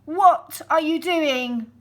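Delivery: loud, high and slow; sly wolf question. Acted. Wolf asking the girl, loud and slow with fake charm. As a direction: draw it out like a wolf pretending to be nice.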